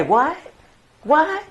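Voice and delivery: confused strangers asking what; baffled, rising what; puzzled. Retold or imitated.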